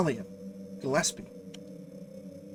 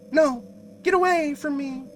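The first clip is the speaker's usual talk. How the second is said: frightened cry; 11 semitones higher, 8 dB louder than usual. Acted. Girl recoils from her mother.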